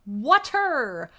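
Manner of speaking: loud, drawn-out mock outcry; exasperated cry. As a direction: cry out the word in hammed-up exasperation. Comic cry over cola.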